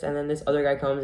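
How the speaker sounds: ordinary talk, nothing marked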